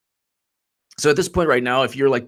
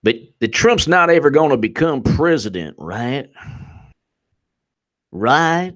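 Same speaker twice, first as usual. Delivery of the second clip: Southern congressman; 4 semitones higher, 3 dB louder than usual. Acted. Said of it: "one of the Southern congressmen's like".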